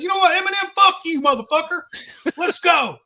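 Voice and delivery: high, loud mock shout; retold drunken challenge. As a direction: call him out like you want to fight. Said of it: "I said".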